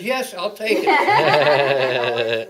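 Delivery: very loud, drawn-out, jubilant yes; eager acceptance. Retold or imitated. New recruit grabs the mail clerk job, loudly eager. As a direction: jump at the offer, breaking into laughter.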